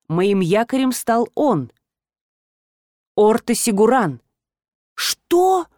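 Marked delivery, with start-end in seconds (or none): 4.9-5.7 очень высокий изумлённый возглас; вскрикнуть вопросом, не веря услышанному; потрясённое удивление. A narrator's reading. Героиня потрясена названным именем. (изумлённо, высоким голосом)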